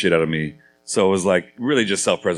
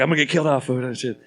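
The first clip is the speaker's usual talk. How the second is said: mock panic; 7 semitones higher than usual. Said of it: "So I was like".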